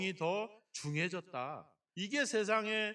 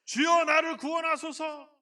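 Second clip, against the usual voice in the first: raised plea for rescue; Peter's cry; 6 semitones higher, 6 dB louder than usual. Acted. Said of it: "베드로가 이 삼십 절에 뭐라 그랬어요".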